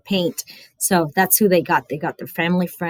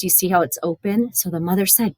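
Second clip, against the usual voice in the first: plain reading voice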